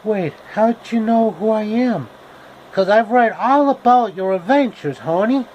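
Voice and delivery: put-on a young girl caught off guard; Dorothy voice.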